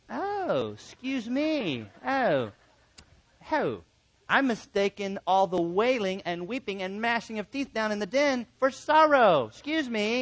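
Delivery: high-pitched, drawn-out mock surprise; sarcastic apology. Counselor answering the relative who claims to be happy, sarcastic. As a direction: lay on the hammed-up apology.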